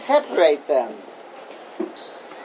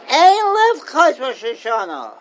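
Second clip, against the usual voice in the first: mock scolding exclamation; 4 semitones higher than usual. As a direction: play the shocked elder, then drop it. Scolding people schmoozing on Rosh Hashanah.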